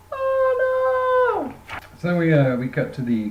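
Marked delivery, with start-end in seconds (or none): 0.1-1.8 high wailing "oh no", breaking into a cry; anguished cry. Retold or imitated. Barbara cries out in mock anguish for her dad. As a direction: cry out in mock despair over his father.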